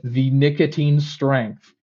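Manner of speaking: "nicotine strength" drawn out slowly; slow, emphatic repeat. Retold or imitated. Customer pointing at the box for the clerk, slow and emphatic. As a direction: spell out "nicotine strength" word by deliberate word.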